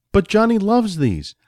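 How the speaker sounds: high, protesting plea; lightly whiny protest; pleading husband. A narrator's reading. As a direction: plead the case to the wife.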